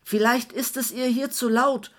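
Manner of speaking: yelling over music that drowns everything; bellowing. A narrator's reading. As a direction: roar it so he can hear you. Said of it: "brüllte Kurt".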